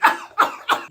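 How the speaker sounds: short wheezy coughing burst; hacking cough. Acted. Sick boy claiming he's fine, coughing. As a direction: cough right after speaking.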